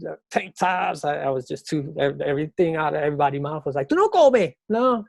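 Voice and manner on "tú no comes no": high, loud mock scolding in Spanish; loud high-pitched teasing impression; imitated scolding. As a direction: imitate a nagging neighbor, pitched way up. Imitates everybody remarking on his skinniness.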